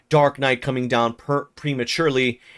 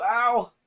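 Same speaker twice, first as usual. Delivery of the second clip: pained yelp; 10 semitones higher than usual. Real, not acted. Cat grabbing arm.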